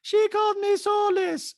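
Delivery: high, slow, soulless monotone; mock soulless complaint, way up high; Diana. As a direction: report it loudly on one high note. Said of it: "she says it like a soulless person".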